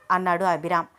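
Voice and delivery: usual speaking voice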